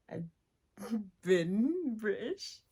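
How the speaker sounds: slow, laughing put-on British accent; mock British accent. Joking to viewers she's British now, in a laughing mock British accent. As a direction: ham up a posh British voice through a laugh.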